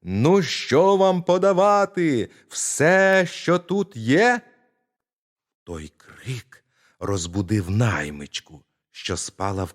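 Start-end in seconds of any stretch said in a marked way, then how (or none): 0.0-4.4 put-on high, loud tiny-boy yell, slow and drawn out; shouting again. Tiny boy yells again to the frightened thieves, high and loud. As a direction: play dumb and yell it out. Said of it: "Кричить знову".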